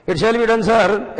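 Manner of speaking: loud, high obedient reply; eager servant. Acted. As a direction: answer the master at once. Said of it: "आगे क्या लिखा हुआ है". (मालिक को ऊँचे स्वर में जवाब)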